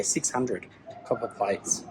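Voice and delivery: normal talking voice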